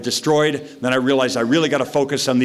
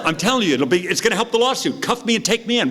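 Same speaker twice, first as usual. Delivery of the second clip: much higher insistent plea; pleading tone; 9 semitones higher, faster than usual. Retold or imitated. Drunk driver insists the cops arrest him, pitched high. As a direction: insist to the officers, voice way up. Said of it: "in this tone of voice".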